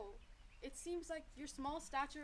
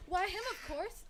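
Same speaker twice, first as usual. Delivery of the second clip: higher, louder little mouse voice; small mouse; 7 semitones higher, 5 dB louder than usual. A narrator's reading. Small mouse answers the wild dogs, piping up. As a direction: pipe up as a tiny mouse. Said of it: "a small mouse said".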